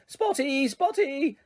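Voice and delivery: high, taunting chant of the name; mocking yell. A narrator's reading. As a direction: jeer at her as you chase.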